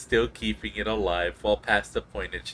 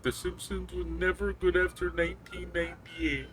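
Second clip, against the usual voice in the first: mock hardline fan; 8 semitones higher, 4 dB quieter than usual. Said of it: "the really hardline folks".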